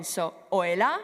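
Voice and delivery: ordinary talk, nothing marked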